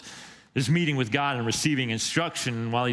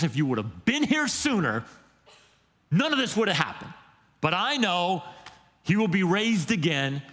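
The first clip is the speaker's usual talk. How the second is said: upset Martha; 6 semitones higher than usual. Retold or imitated. Martha continues, higher voice.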